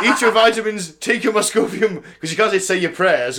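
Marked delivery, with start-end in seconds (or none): 0.0-2.0 Hulk Hogan promo voice. Retold or imitated. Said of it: "Hulk Hogan's like".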